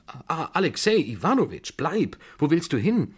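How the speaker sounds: high, agitated cry to stay; urgent calling back. A narrator's reading. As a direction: call after him as he leaves. Old woman calls him back. (aufgeregt zurückrufend)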